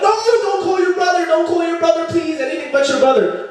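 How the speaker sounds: very high-pitched, hammed-up pleading; panicked begging. Boyfriend begging girlfriend not to call her brother, high panic. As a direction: beg in a comic panic, voice shooting up.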